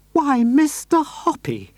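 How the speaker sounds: lady thrilled by the proposal; delighted surprise. Acted. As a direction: coo in happy surprise. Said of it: "she cried".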